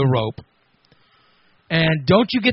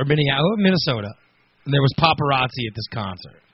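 usual speaking voice

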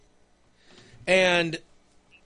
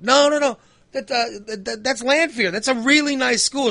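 reassuring dad; 7 semitones higher, 3 dB louder than usual. Retold or imitated. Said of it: "I'm saying stuff like".

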